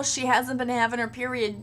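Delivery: ordinary delivery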